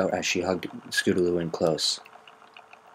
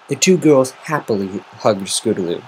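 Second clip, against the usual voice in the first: ordinary delivery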